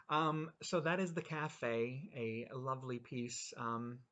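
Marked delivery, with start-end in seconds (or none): none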